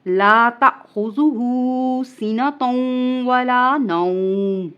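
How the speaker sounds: slow drawn-out chant; Quran recitation. Acted. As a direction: stretch the words out in tilawat. Recites Ayat al-Kursi.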